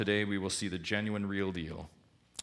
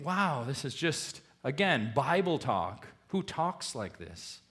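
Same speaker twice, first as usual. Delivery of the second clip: skeptical reaction; 6 semitones higher than usual. Retold or imitated. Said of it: "you can think".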